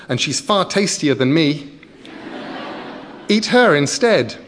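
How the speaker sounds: put-on high, loud child urging; pleading child. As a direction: push the troll toward her. Child urges troll.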